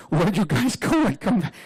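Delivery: high, drawn-out mock call after a departing car; comic high-pitched yell; plaintive calling out. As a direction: imitate a man left behind, calling high. Voices teammate calling after leaving car.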